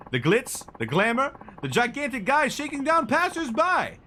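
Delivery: high, loud, gushing photographer voice; an over-eager paparazzo spotting his big shot; excitable photographer. Acted.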